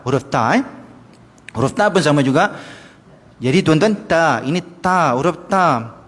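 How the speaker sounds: usual speaking voice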